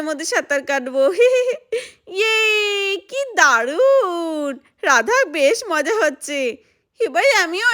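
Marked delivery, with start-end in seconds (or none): 1.2-1.8 short high giggle of delight; a child giggling with joy on a float; high gleeful giggle. Acted. Gleeful laugh in the pool. 2.1-6.6 slow, drawn-out squeal of joy; a child cheering on a pool float; gleeful cheer. Acted.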